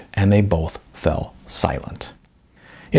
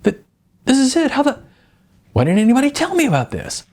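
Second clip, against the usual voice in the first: excited; faster than usual. Acted. Terrence thrilled by finding his film.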